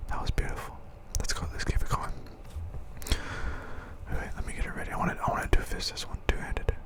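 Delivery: soft whispered asides; breathy ASMR whisper to viewers. Real, not acted. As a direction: keep the commentary in a hushed ASMR whisper.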